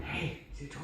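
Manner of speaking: whispered tease to the dog. Shown as a demonstration. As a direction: whisper it to tempt her. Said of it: "one way is to get 'em".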